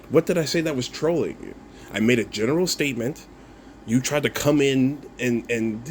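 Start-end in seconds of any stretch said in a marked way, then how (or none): none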